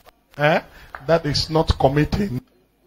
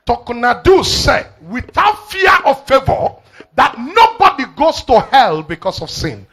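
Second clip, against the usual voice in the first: preaching at full force; 7 semitones higher than usual. Acted. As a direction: lift it to the whole church. Preacher repeats the quote.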